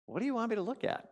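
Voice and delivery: high, quick questioning wife; imitated wife. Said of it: "And she goes".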